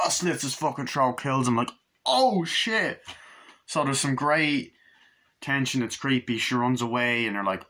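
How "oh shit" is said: high, startled exclamation; mock shock. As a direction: exclaim as if stunned by the kill. Reacting to retold killing.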